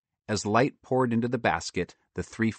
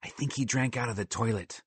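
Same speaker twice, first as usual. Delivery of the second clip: soft aside; whispering; 2 semitones higher, 5 dB quieter than usual. Acted. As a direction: quietly tell a friend. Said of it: "Sadie whispered to Justin".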